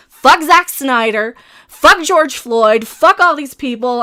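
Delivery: loud, slow, heavily stressed curses; angry cursing. Real, not acted. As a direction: pound out each curse with real fury. Cursing everyone in fed-up rant.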